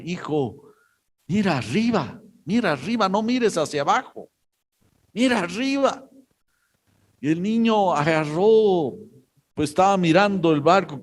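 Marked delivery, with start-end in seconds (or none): 0.0-6.0 a mild mock shout, climbing higher with each "mira arriba"; calling upward, rising higher and more urgent; calling up to son. Captain calling to his son atop the mast, a mild mock shout rising higher. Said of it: "le empieza a gritar".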